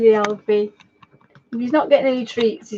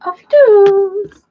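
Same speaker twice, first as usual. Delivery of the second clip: loud, high, drawn-out call; 7 semitones higher, 7 dB louder, slower than usual. Real, not acted. Owner shouts for her dog to come back, calling out. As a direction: sing out a long shout for the dog. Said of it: "If we shout him".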